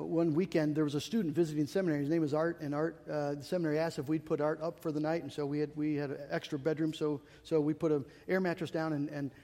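usual speaking voice

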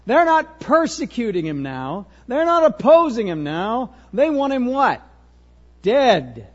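loud, high, punched preaching; forceful preaching. Real, not acted. Preacher builds at full force.

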